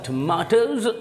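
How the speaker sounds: tomatoes the British way, "tuh-MAH-tohz"; British pronunciation. Shown as a demonstration. Teacher reads the example sentence, British "tuh-MAH-tohz". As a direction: give tomatoes a long British "ah". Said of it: "British people pronounce it tomato".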